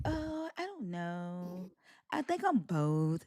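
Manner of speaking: slow, low and soft mock hesitation; mock unsure answer. Host imitating the guest who was asked about belief, mock unsure. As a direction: imitate a clueless, dragged-out reply.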